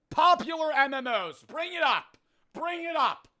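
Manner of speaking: high, heated protest; indignant demand. Real, not acted. Demands proof after losing.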